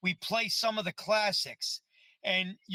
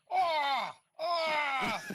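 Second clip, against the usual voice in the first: short higher mock yells, laughing into the second; mock scream; 6 semitones higher, 4 dB louder than usual. Retelling a co-star screaming at the electrocution, mock yells. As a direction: yelp in fake pain.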